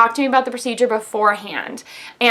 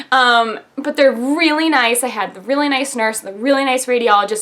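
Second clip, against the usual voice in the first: ordinary talk, nothing marked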